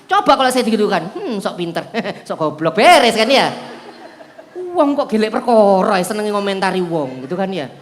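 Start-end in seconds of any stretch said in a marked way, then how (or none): 1.2-2.8 sneering comeback. Retold or imitated. Preacher's comeback joke.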